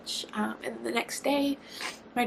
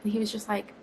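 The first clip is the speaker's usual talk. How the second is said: ordinary talk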